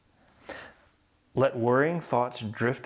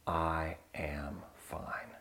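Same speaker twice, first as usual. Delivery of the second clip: slow hushed whisper; soft spa whisper; 6 semitones lower, 11 dB quieter, much slower than usual. Real, not acted. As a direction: let it fade to a breath. Closing comforting mantra.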